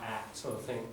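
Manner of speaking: usual speaking voice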